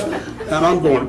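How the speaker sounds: usual speaking voice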